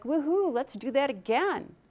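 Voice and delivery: mock roller-coaster excitement; thrill-ride whoop. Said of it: "you're like".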